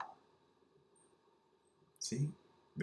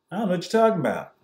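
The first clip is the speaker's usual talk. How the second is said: higher, fast mock innocent denial; feigned ignorance; 7 semitones higher, 4 dB louder, much faster than usual. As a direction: play dumb, rattle it off fast.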